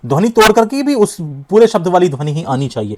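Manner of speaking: ordinary delivery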